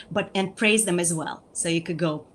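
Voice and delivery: ordinary delivery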